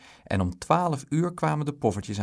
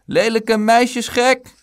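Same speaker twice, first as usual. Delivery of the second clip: loud, slow, high jeer; high drawn-out bully's shout; goading taunt; 12 semitones higher, 11 dB louder, slower than usual. A narrator's reading. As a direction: yell the insult, dragging it out. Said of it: "sarde Bruin".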